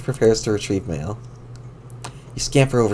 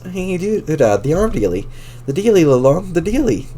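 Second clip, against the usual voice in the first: usual speaking voice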